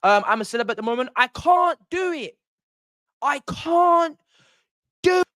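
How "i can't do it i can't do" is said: high, slow whiny "I can't do it"; Sam Thompson meme. Retold or imitated. Fan imitates a TV celebrity meme for his mate, high slow whine. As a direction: whine it like a reality star at the end of his rope. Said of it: "That's the meme, bruv".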